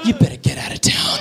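hushed menacing whisper; breathy whispered warning; whispered threat. Acted. Intimidation attempt.

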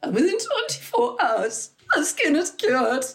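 high, emotional caller voice; imitating a tearful customer. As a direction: play the grateful mum choking up on the phone. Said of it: "people would phone up and say".

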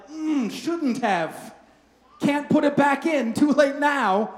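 a comic 'oops' after saying too much; mock regret. Pastor acting out the moment you regret your words, sheepish.

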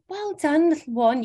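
put-on baby voice. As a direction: praise a baby in a cooing voice. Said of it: "the whole sort of baby voice".

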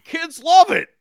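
high, loud cheery fan; Midwestern fan. Acted. A Midwestern fan cheers the hot dog gun, high and loud. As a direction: sell it like a proud dad.

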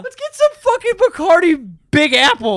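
high, loud bro voice; party bro voice. Pretend. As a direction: hype the drink like a teen. Bro bit continues.

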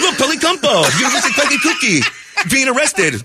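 mocking through laughter; high voice breaking into laughs. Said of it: "I go".

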